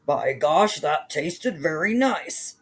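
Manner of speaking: slow, satisfied giant grumble; giant muttering. Acted. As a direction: savour it slowly like a giant. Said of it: "He heard the giant mutter".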